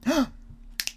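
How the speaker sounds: sharp unvoiced gasp of delight; quick excited intake of breath. Real, not acted. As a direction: gasp at what you just saw. Finds the artist's images.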